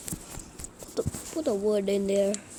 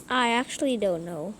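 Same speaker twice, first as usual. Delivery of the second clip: usual speaking voice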